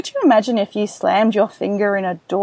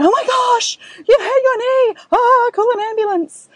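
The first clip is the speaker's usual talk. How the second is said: much higher and louder, hammed-up panic; overreacting panicked parent; 5 dB louder than usual. Shown as a demonstration. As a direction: shriek like the knee is a disaster. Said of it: "that's just going to take their levels up higher".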